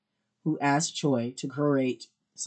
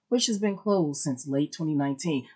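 ordinary talk

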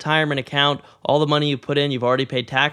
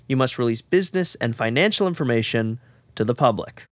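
normal talking voice

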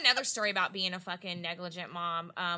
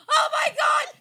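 high, loud re-enacted scream; retold scream; 10 dB louder, slower than usual. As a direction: scream it like a horrified mother, retelling. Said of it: "And I'm screaming".

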